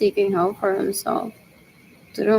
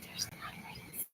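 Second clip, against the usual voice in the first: whispered aside; 27 dB quieter than usual. Retold or imitated. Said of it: "looked at my parents like".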